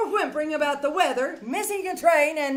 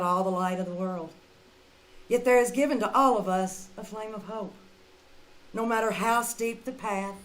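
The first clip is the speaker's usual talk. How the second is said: sincere closing address; 7 semitones lower, 6 dB quieter than usual. Acted. Charlotte bids listeners good night, soft and sincere. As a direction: speak gently, like a blessing to the audience.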